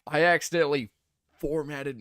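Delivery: loud, high attempt at cartoon cowboy; half-formed animated toy voice, pushed up; Woody. Streamer attempting requested formatted-drive line, high cartoon cowboy voice. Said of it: "Do an impression of Woody".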